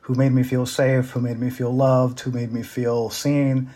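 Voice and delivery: ordinary delivery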